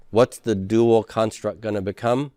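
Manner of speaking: usual speaking voice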